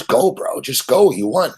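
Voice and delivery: high, pleading security guard telling him to go; urging guard. Retold or imitated. Casino guard urging the drunk gambler to leave, high and insistent. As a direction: push him out the door, urgently.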